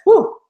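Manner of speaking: high, loud cheering woo; excited whoop. Real, not acted. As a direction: let out a big excited woo. Host cheers at webinar start.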